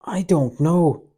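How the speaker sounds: high, slow, drawn-out wail. A narrator's reading. Judas wails his denial to angry Peter. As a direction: wail it out, pleading innocence. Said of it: "wailed Judas".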